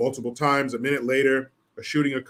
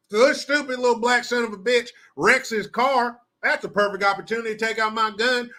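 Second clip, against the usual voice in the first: Southern cop voice; 8 semitones higher than usual. Acted.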